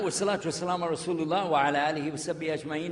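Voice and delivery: rapid Arabic recitation on a narrow tune; formal opening praise, quickly recited; Arabic opening invocation. Real, not acted. Speaker opening his lecture with Arabic praise, recited formally.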